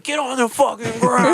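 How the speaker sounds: high, strained mock police yell; hammed-up high-pitched command; shouting cops. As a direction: act out cops yelling during a tackle. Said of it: "They're like".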